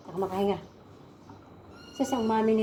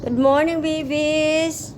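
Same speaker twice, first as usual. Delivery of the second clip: cheery greeting; 4 semitones higher, 5 dB louder, much slower than usual. Real, not acted.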